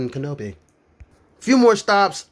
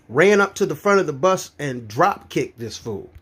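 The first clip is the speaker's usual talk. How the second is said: ordinary delivery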